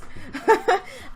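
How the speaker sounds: bright high giggle; real laugh. Real, not acted. Laughing at own joke.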